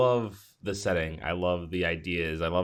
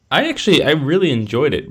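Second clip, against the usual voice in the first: excited; 6 semitones higher, 9 dB louder than usual. Real, not acted. Enthusing about the movie.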